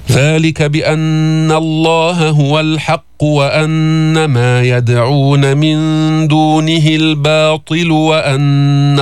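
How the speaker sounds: slow, drawn-out tajweed chant; Quran recitation. Shown as a demonstration. Teacher reciting to students in slow chant. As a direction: recite each word long and measured.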